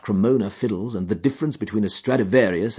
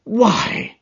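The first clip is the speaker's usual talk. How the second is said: sharp, higher surprised "Why"; startled cry; 12 semitones higher than usual. A narrator's reading. Watson reading the alarming note, startled exclamation. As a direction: catch your breath on it. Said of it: "I cried".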